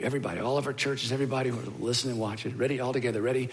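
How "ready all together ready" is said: ordinary talk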